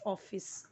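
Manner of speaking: ऑफिस with a rounded o; correct pronunciation. Shown as a demonstration. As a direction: say ऑफिस slowly as "off-iss". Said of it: "तो वो wrong हो जाएगा".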